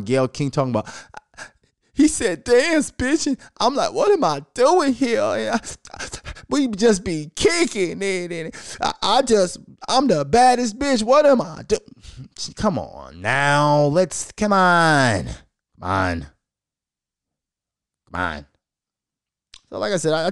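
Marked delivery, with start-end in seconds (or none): 1.9-11.8 put-on high, breathy innocent-girl voice. [name] telling [name] her side, high mock-innocent voice. 12.6-18.5 come on. Real, not acted. Disbelief drawn out.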